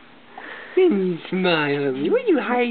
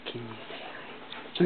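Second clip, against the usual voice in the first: breathy whisper, slow and hushed; whispered coaxing; 7 semitones lower, 12 dB quieter, slower than usual. Real, not acted. Parent whispering to the baby to say hi, slow hush.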